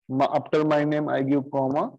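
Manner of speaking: ordinary delivery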